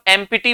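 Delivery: "एम्प्टी" as em-pi-tee; Indian pronunciation. Shown as a demonstration. Teacher showing the common Indian way, p pronounced. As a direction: say "एम्प्टी" Indian style, p fully sounded. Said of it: "Indian language के according".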